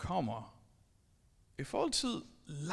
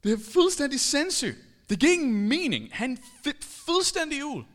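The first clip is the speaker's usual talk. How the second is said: louder, higher disbelief; incredulous excitement; 6 semitones higher, 8 dB louder than usual. Real, not acted. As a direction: exclaim it's insane. Outraged about the slap.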